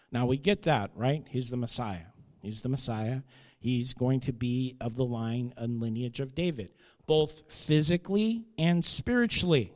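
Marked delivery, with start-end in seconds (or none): none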